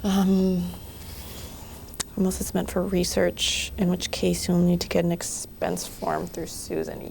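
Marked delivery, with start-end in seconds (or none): none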